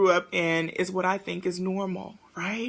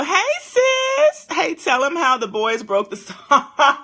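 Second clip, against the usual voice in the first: excited call; 6 semitones higher, 8 dB louder, slower than usual. Real, not acted. Calling to friend.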